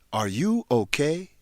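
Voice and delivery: ordinary talk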